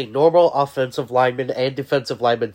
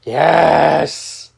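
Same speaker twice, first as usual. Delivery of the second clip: excited shout; 4 semitones higher, 11 dB louder than usual. Real, not acted. Celebratory shout.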